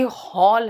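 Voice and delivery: हॉल drawn out with an open aw; correct open vowel. Shown as a demonstration. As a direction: stretch हॉल slowly, rounded vowel. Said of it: "नहीं भैया". (हॉल को लंबे खुले "ऑ" से)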